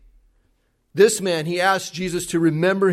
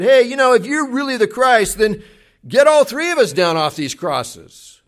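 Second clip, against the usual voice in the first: much higher, louder sneering taunt; mock him with a sarcastic sneer; 8 semitones higher, 8 dB louder than usual. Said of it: "He sarcastically said".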